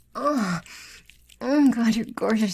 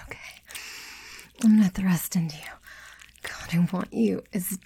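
ordinary talk

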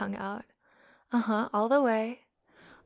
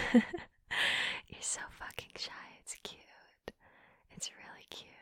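slow whispered giggle; laughing whisper; 6 dB quieter, slower than usual. Acted. Teasing the shy listener, whispered laugh. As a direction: laugh softly through the tease.

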